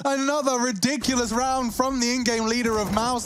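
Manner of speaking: high shrieking disbelief; excited screaming caster. Real, not acted. Caster erupts at play.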